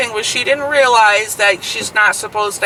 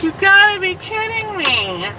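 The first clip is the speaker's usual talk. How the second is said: disbelief; 10 semitones higher than usual. Retold or imitated. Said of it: "I was like".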